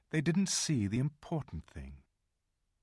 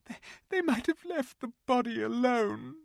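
tearful. A narrator's reading. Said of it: "sobbed Lucy".

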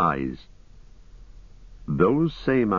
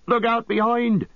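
very high-pitched warning yell; desperate shout. A narrator's reading. Sam warning his master of the attacker behind, desperate shout. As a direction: shout it high with all your remaining breath. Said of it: "gathered all his remaining breath to shout".

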